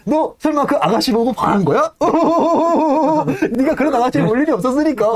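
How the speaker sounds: high mocking voice broken by laughter; laughing tease. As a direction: cackle while ribbing him. Stoker mocking Yank about the lady.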